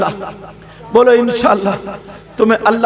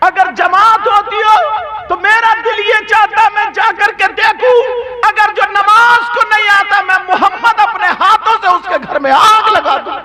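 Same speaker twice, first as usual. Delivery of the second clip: high-pitched, ringing sermon delivery; full-force preaching; 10 semitones higher than usual. Acted. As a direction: raise the voice high to declare the quoted warning. Preacher quotes the Prophet forcefully. (ऊँची आवाज़ में जोशीला बयान)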